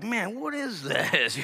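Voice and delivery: slow, high, drawn-out bafflement; baffled reader. Acted. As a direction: stretch it out like you can't make sense of it. Bible reader baffled, acted.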